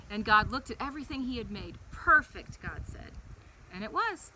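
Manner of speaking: put-on gentle storybook read-aloud for a child; animated children's Bible story voice. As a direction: tell it like a bedtime story.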